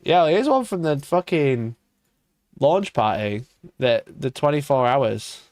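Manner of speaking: usual speaking voice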